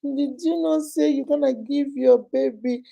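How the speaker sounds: put-on high, tiny whining baby voice; mock baby whine with little sobs; baby-voiced side chick. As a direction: whimper and beg like an infant. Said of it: "turn into even three month children".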